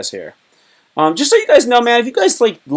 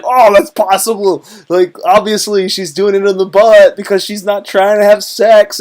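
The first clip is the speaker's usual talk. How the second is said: mock crowd realization; 8 semitones higher, 8 dB louder than usual. Everybody's reaction, mock realization.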